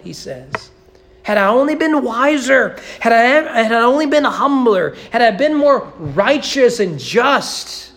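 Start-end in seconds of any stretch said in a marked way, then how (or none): 1.2-7.9 pleading regret. Acted. David's imagined regret, quoted.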